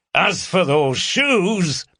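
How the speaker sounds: a hint of a bluff northern tease; mild mocking call from the doorway; lightly voiced teasing inspector. A narrator's reading. Oldroyd calls back laughing.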